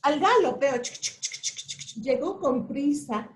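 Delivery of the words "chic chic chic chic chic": unvoiced chic clatter; galloping hooves. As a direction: whisper the hoofbeats. Horse galloping sound. (galope susurrado)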